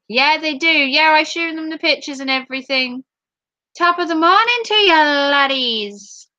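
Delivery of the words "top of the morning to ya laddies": higher, hammed-up Irish greeting; mock Irish accent. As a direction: greet them in a put-on Irish lilt.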